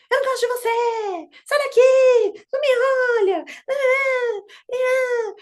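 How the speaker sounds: put-on high, loud shrieking child tantrum; tantrum yelling. Mother acts out the toddler's tantrum at her, high loud screaming. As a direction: scream it like a toddler kicking you out.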